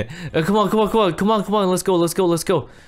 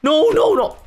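panicked no; 9 semitones higher than usual. Acted. Attack resumes.

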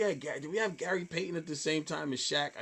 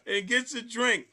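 laughing disbelief; 8 semitones higher, 4 dB louder than usual. Real, not acted. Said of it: "laughing disbelief at the judge".

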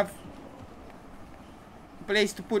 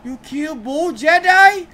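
loud, high, slow disbelief; can't believe what just happened; stunned outburst at the game; shocked; 7 semitones higher, 9 dB louder, slower than usual. Real, not acted. Shocked at cutscene reveal.

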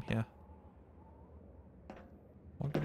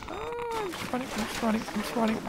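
panicked; 13 semitones higher, faster than usual. Real, not acted. Panicked fleeing, high voice.

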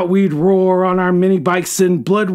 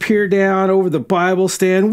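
usual speaking voice

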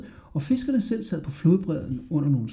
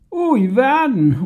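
high, loud mocking cry; mocking shout; 10 semitones higher, 5 dB louder than usual. A narrator's reading. Fishermen jeering at Hodja, loud mocking shout. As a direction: jeer it back at the boy. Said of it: "råbte de".

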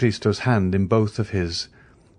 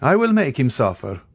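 raised, vengeful vow; mild anger, pitched up; restrained anger; 6 semitones higher, 4 dB louder than usual. A narrator's reading. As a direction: declare it with contained anger. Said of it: "first movement of anger he had betrayed".

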